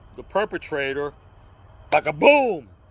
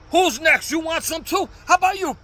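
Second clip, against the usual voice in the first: high, loud taunting challenge; thug taunting; 11 semitones higher, 5 dB louder than usual. Retold or imitated. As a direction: bark it at the whole train, much higher. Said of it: "He says".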